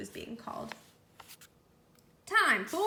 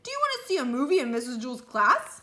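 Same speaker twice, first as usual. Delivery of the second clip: louder calling voice; calling across; 2 semitones higher, 7 dB louder than usual. A narrator's reading. Damien shouting invitation to Lewis, loud call. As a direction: keep shouting it over the distance.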